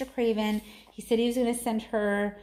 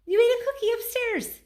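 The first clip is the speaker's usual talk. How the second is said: indignant accusation; 5 dB louder than usual. Real, not acted. Mother accuses son.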